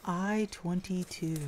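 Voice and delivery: slow, drawn-out bingo call; bingo caller. Pretend. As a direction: call the number across the hall. Jokingly calling bingo numbers.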